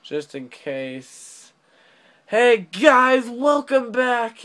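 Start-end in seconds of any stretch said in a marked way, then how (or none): 2.3-4.4 hyped greeting. Real, not acted. Video intro greeting.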